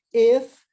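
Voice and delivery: loud "if" said as "ef"; "if" forte como "ef"; if with e sound. Shown as a demonstration. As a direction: punch "if" as "ef". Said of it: "é o mesmo que se".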